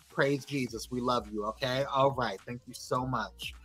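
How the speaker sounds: low, subdued shout-out; dutiful, low-energy well-wishing; unenthusiastic. Real, not acted. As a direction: give the shout-out with little enthusiasm. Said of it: "say that with some more enthusiasm".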